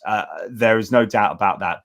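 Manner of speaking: ordinary talk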